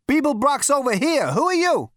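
very high, loud challenge; calling out. A narrator's reading. Zaphod challenging the stranger down the corridor, high loud call. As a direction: shout across a distance. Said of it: "called Zaphod".

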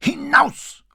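hoch und laut herausgeschrien; fast gebrüllt. A narrator's reading. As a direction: ein scharfer, hoher Befehlsschrei. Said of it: "brüllte Santo Mauro jetzt fast". (wütend hinausbrüllen)

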